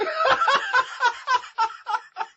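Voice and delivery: high loud laughing fit; loud laughter. Real, not acted.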